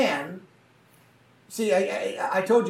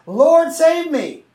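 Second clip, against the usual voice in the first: slow, high, louder plea; cry for help; 8 semitones higher, 9 dB louder, much slower than usual. Acted. Peter sinking in the waves cries out to Jesus, slow and pleading. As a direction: call out as you start to sink. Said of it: "he cried out".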